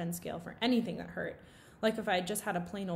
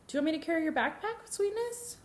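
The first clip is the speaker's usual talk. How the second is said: higher, fast, fussing mother; doting mom; 9 semitones higher than usual. A narrator's reading. Mom fussing to carry her son's backpack, doting. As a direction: coo over him like a worried parent.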